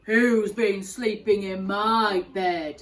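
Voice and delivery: loud, slow gruff bear; Daddy Bear. Acted. Daddy Bear finding his porridge eaten, loud slow growl. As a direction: boom it out like a big father bear. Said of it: "said Daddy Bear".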